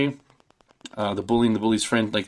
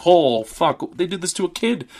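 excited shock; 8 semitones higher than usual. Retold or imitated. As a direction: blurt it out, thrilled and shocked. Reacting to the gory injury shot.

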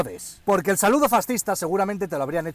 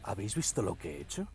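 much lower, much softer smug mumble; mock him as a self-satisfied fool; imitating a pompous dimwit; idiot's voice; 11 semitones lower, 14 dB quieter, faster than usual. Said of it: "Hablan con esa voz de gilipollas".

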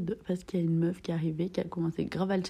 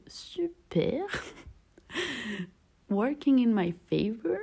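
mock sarcastic; 5 semitones higher, slower than usual. Sarcastic inner thought about rival.

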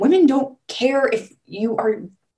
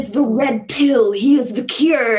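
mock angry fan; 4 semitones higher, 4 dB louder, slower than usual. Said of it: "But people are like".

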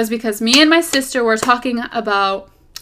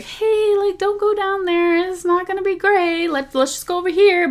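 steer them away kindly, pitched up; God gently warning; 9 semitones higher than usual. Retold or imitated. God warning a wandering believer, high and gentle. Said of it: "he'll be like".

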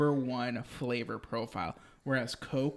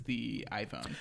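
whispered; 4 semitones lower, 7 dB quieter, slower than usual. Real, not acted. Punchline comparison, whispered.

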